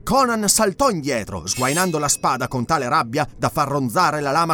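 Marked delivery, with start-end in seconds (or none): none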